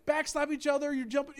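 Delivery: ordinary talk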